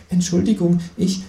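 ordinary delivery, nothing marked

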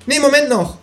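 high kangaroo voice, pleased with itself; the kangaroo gloats about its scheme; cheeky kangaroo. A narrator's reading.

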